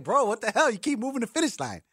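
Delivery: high, loud, fed-up shout; exasperated retort. Retold or imitated. Answering his ex-wife, fed up, high exasperated shout. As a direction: snap back at her, fed up. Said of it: "And I'd be like".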